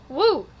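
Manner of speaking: contained little "woo"; easy demonstrated "woo"; moderate woo. Shown as a demonstration. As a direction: whoop gently, holding back. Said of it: "You just gotta go".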